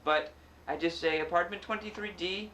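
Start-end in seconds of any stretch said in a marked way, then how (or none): 1.2-2.5 high, unplaceable mock accent, a little Indian or Irish; badly done nonspecific accent; vague put-on accent. Shown as a demonstration. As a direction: say it in a bad generic foreign accent. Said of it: "do whatever accent I think of".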